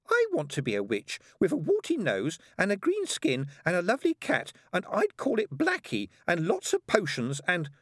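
put-on high child's voice listing wishes; high-pitched childish enthusiasm; eager little girl. A narrator's reading. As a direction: chatter like a six-year-old dreamer.

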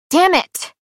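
high, loud outburst; sudden high-pitched shout of frustration; frustrated yell. A narrator's reading. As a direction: yell the curse at nothing. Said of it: "I'm yelling at nothing".

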